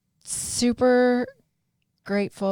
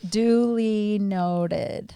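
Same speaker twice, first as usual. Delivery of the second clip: very slow, drawn out; much slower than usual. Acted. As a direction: drag out Duly noted very slowly. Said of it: "I don't know who that might have been directed to".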